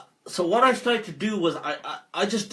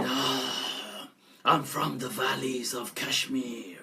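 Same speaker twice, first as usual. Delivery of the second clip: jinn voice; 3 semitones lower, 4 dB quieter, slower than usual. Retold or imitated. Jinn answers through possessed man.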